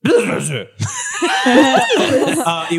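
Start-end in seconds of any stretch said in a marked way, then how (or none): 0.1-0.7 loud, high, curt bee buzz. Acted. Game master as hostile bee answering, loud buzz. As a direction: buzz a sharp refusal.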